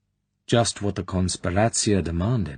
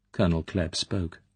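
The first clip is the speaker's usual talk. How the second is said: ordinary delivery, nothing marked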